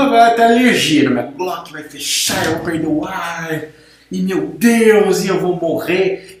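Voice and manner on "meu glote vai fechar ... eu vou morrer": exaggerated, slowed panic, mocking a dramatic friend. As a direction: ham up a fake allergy crisis, drawn out. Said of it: "por que que tudo com você é um drama". (imitação exagerada e dramática)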